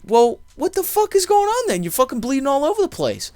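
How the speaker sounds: panicked yell. Retold or imitated. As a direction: yell in disbelief at the mess. Retold freak-out at the bleeding.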